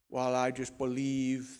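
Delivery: put-on slow, half-hearted Austrian accent; attempted Schwarzenegger accent. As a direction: try an Austrian action-star accent, badly. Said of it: "then I can't do his accent".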